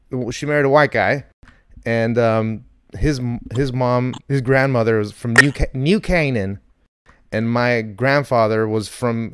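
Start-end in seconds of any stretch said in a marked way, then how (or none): none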